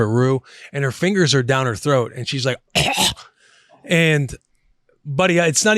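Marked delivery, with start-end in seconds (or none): 2.6-3.1 short throaty choking noise, mimicking a child; imitated gag. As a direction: act out a gag on something stuck.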